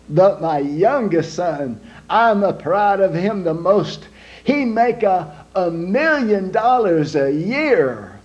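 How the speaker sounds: put-on slow, proud Italian accent; Italian papa boasting slowly in an Italian accent. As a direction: brag about your son in a broad Italian accent. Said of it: "an Italian man named Big Lorenzo".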